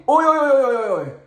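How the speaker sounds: very high, rapid chanted cry; mock judges. Imitating judges in a courthouse, high rattled-off cry. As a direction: imitate judges calling out in a high falling run. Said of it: "So the judges would be".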